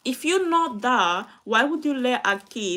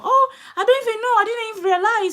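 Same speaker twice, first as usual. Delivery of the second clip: high, loud, fast mock-innocent whine through tearful laughter; imitating the school playing dumb; hammed-up shrill staff voice with a tearful laugh; mocking staff excuse; 12 semitones higher, 6 dB louder, faster than usual. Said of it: "they never know".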